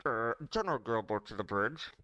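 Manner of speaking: tinny ship intercom announcement; muffled PA voice. Acted. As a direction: hand over mouth like a loudspeaker. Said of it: "put my hand over my mouth".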